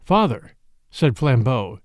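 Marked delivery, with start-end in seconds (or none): none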